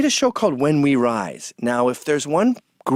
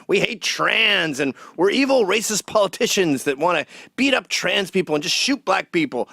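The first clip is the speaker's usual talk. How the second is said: ordinary delivery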